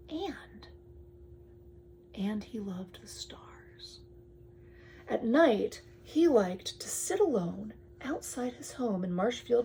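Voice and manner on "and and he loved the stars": slow soft whisper; whispered bedtime-story line; hushed reading. Acted. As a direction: draw them in with a slow whisper.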